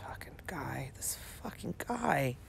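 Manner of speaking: hissed under breath; whispered disgust. Real, not acted.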